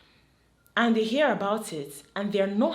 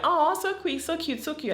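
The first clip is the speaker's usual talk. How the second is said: "so cute" gushed much higher, cooing; gushing "so cute"; 9 semitones higher than usual. Retold or imitated. Everyone gushing over the hand-holding, higher cooing. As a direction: coo over it like a sweet moment. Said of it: "everybody was like".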